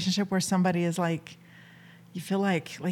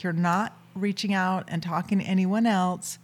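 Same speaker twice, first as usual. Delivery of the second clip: ordinary delivery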